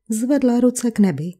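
usual speaking voice

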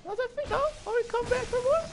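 very high squeaky taunting voice; high mocking taunt.

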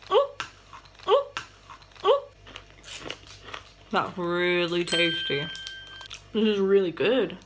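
talking while chewing; biting a crunchy stick; mouth full of candy. Real, not acted. Seen 1: Eats candy stick throughout, bites it at 2.5–3.8s, then chews.

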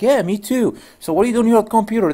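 high, quick and eager; eager coworker. Retold or imitated. Coworker eagerly asks John about his new computer, fast and high. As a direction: rattle off the question, all curious.

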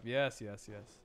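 ordinary talk

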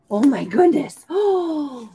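gasping oh of storybook shock; mock surprise. Teacher gasps at the monster page for the kids. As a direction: pretend to be startled by the picture.

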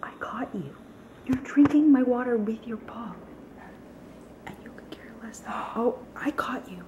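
soft whisper to the dog. Real, not acted. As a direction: hush it, unvoiced, to the puppy.

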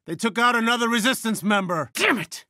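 high, distressed report breaking into a curse; upset outburst. Acted. Commander reporting a fallen resistance member, upset outburst. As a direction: pitch up, curse the loss.

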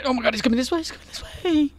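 rising panic, pitch climbing; frantic as the monster nears; panicked. Real, not acted. Monster approaching in game.

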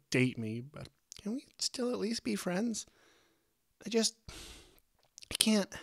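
very high, distressed, faltering apology; upset high voice begging to stay friends; tearful pleading. A narrator's reading. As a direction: blurt it out near tears, trailing off. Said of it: "Angel trailed off, sniffling".

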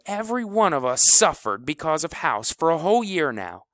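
loud, high furious rant. Acted. Kleebo rants at the team about House, loud and furious. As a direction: rail at the whole team. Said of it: "Kleebo was a geyser".

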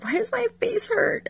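high puzzled whine; confused whine. Retold or imitated. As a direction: whine it in disbelief. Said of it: "and I was like".

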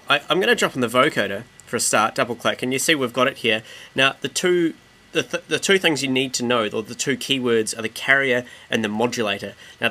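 ordinary talk

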